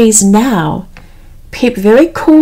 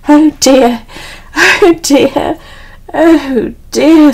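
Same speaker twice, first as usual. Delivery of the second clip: higher-pitched, mildly worried Piglet; fretful worry; 5 semitones higher, 4 dB louder, slower than usual. A narrator's reading. Piglet to himself as he nears the trap, fretful. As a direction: fret it in a lifted storybook voice.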